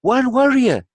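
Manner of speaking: high, loud cry of disbelief; incredulous boy. A narrator's reading. Boy hearing of the lone warrior, incredulous. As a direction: exclaim as a boy who can't believe it. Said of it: "The boy is incredulous".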